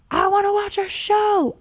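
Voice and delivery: high, loud, drawn-out mock kid whine; whining kid. Mom imitating her kids begging for TV, whining.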